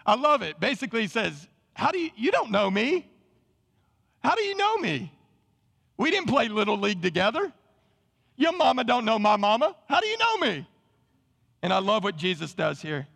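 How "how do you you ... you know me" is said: high-pitched, disbelieving retort; incredulous challenge. Acted. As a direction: question it like a suspicious stranger. Acting out Nathanael's suspicious reply.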